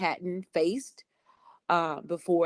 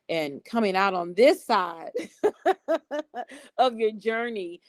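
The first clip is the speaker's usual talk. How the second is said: laughing; 4 semitones higher than usual. Real, not acted. Laughing in gratitude.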